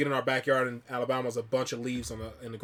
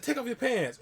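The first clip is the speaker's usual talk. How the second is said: higher-pitched, insistent mimicry; imitated girl's demand; 10 semitones higher than usual. Older girl ordering younger boys, imitated high-pitched demand.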